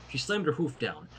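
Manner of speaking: plain reading voice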